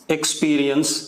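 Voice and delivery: word-by-word dictation with long pauses; slow dictation. Real, not acted. Teacher dictating a dipole statement to students, slow dictation. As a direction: dictate slowly, leaving gaps to write.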